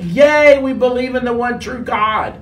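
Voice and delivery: high, loud burst of celebration, easing off; mock crowd cheer. People of Nineveh answering Jonah's preaching, hammed-up joyful cheer. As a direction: cheer it like a whole converted crowd. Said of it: "and they all went".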